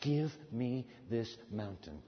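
low, soft pleading prayer; hushed low prayer. Shown as a demonstration. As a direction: pray it quietly and low. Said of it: "ask God for the mountain".

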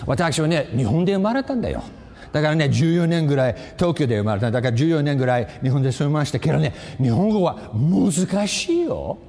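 fast casual Japanese, slowing on the last line; speaking Japanese. Real, not acted. Preacher teasing the students in Japanese. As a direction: slip into Japanese to tease the students. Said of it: "I'm speaking the language of heaven".

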